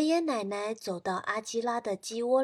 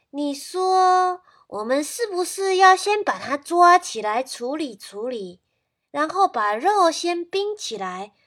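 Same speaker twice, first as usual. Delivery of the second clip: a grandma plotting to cook the rooster; grandma suggesting; 3 semitones higher, 7 dB louder than usual. Acted. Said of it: "奶奶说". (奶奶提议)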